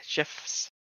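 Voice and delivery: breathy whispered Jeff's; whispered name. Real, not acted. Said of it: "the way you said Jeff".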